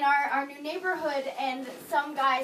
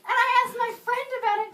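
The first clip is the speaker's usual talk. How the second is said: ordinary talk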